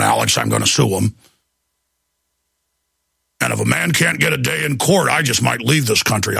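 Ventura impression. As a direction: speak low like Ventura grumbling. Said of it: "he was already talking about it".

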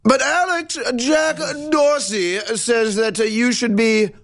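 very high, silly put-on voice; fawning fan. Co-host parodying Dorsey's admirer to Alex, high mocking. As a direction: plead in a squeaky mock-fan voice.

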